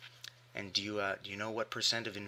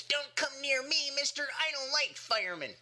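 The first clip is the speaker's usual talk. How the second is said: put-on snotty kid. A kid refusing the fireman, snotty high whine. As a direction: whine like a spoiled little kid. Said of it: "best impression of a snotty kid".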